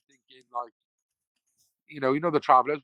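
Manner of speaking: normal talking voice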